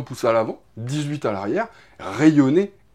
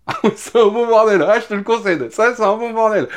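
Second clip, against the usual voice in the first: amused; 7 semitones higher, 5 dB louder than usual. Real, not acted. Amused at the odd design.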